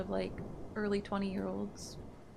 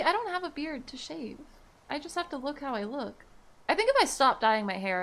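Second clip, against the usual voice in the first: ordinary delivery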